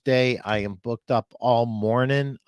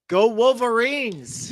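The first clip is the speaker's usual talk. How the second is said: team cheer; 11 semitones higher, 5 dB louder, slower than usual. Real, not acted.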